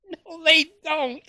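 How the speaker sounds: high, slow sarcastic no; mocking denial. To viewers about church charity, mocking high-pitched denial.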